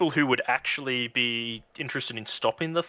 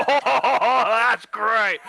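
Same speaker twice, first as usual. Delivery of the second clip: high, louder gleeful cry; delighted brute; 10 semitones higher, 6 dB louder, much slower than usual. Acted. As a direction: cheer at the explosion. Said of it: "He's like".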